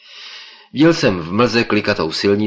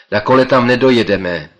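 louder, higher call into the wind; shouting against wind; 4 semitones higher, 7 dB louder than usual. Acted. Narrator shouts to the professor against the wind. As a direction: shout over the rushing air. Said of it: "zvolal jsem proti větru".

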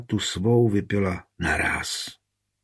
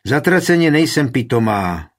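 louder, higher angry snap; angry outburst; 5 semitones higher, 6 dB louder than usual. A narrator's reading. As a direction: spit it out in anger. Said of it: "rozlobila se Markétka". (rozzlobeně, hlasitě)

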